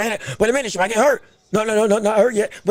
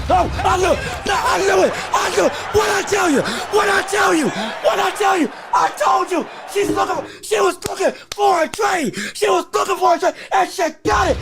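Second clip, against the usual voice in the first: excited shouting; 7 semitones higher than usual. Real, not acted. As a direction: yell it out in triumph. Knockdown called, celebrating loudly.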